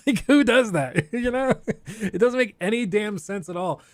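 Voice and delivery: high laughing voice over the words; giggling while talking. Real, not acted. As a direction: laugh through the disbelief. Laughing at the absurd hiring.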